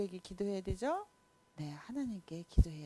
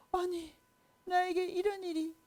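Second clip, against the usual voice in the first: amazed king; 10 semitones higher than usual. Acted. King amazed at good news.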